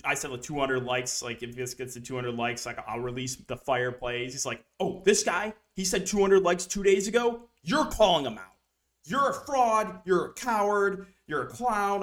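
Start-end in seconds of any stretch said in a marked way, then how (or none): 4.8-12.0 higher, indignant rant at the streamer; jeering the fraud and coward lines; mock angry troll. Troll ranting at the streamer in mock outrage.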